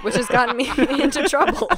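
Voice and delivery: high, laugh through the words. Real, not acted. Admitting bluffed orders caused trouble, laughing. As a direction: admit it while cracking up.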